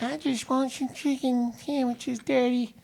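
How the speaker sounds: put-on high, slow little-girl plea; mock little girl asking daddy. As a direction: beg like a small child for sandwiches.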